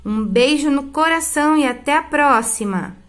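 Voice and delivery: ordinary talk, nothing marked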